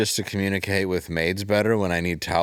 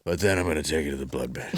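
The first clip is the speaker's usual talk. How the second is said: lower, softer, fast mumble; low fast Seagal mumble; 6 semitones lower, 5 dB quieter, faster than usual. Retold or imitated. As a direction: rush it out low and soft. Mocking Seagal's line.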